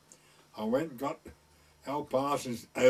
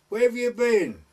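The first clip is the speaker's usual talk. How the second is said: retold scold, much higher and louder; woman at her window scolding the lad below; 9 semitones higher, 9 dB louder than usual. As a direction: demand an answer sharply.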